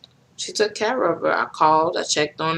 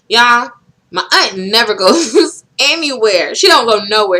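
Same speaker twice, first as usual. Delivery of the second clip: excited; 5 semitones higher, 7 dB louder than usual. Real, not acted. Excited disbelief at aunt's visit.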